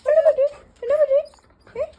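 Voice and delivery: high, loud coaxing voice to the dog; high pet talk. Real, not acted. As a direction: ask the dog sweetly and loudly. (നായയോട് ഉയർന്ന കൊഞ്ചൽ ശബ്ദം)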